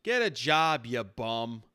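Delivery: loud dismissive shout at a beggar; yelling insult. Acted. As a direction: bark it at the bum like a rude passerby.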